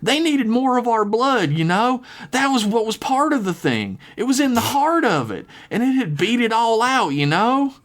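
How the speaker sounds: eerie, high and pleading; high southern girl voice. Acted. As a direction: confess it in a high creepy lilt. Lizzie's eerie confession.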